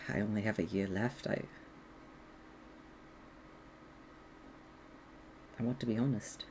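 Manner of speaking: soft, low, halting confession; confessing dragon. Acted. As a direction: confess quietly, with long hesitant pauses. Game master plays confessing dragon.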